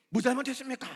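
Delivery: loud, high, indignant protest; indignant shout. Acted. Jonah shouts indignantly back at God. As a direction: shout back like a sulking prophet.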